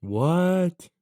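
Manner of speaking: high-pitched, disbelieving outburst; incredulous. Real, not acted. Co-host hears both IDs were refused, incredulous. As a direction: react in real disbelief, pitch jumping up.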